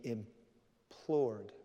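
very slow, stretched delivery; solemn drawn-out word. Real, not acted. Preacher recounting the cross, solemn and drawn-out. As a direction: draw the word out slowly.

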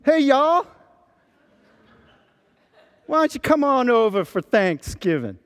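high, bright-toned Texas twang on hey y'all; Southern accent. Retold or imitated. Pastor playfully inviting the congregation to Thanksgiving in a Southern accent. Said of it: "Picture me saying".

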